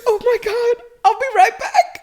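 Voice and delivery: very high, squeaky mock excitement; gushing clerk. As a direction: squeal it in falsetto. Clerk gushing over accent, retold.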